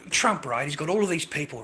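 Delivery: ordinary talk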